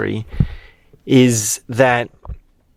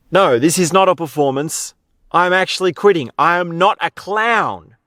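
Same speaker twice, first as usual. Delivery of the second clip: raised, emphatic mock protest; insistent clown; 6 semitones higher than usual. Clown telling the crowd he's quitting, insistent retold protest. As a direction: lightly act out a performer insisting he's serious. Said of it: "So he says to them".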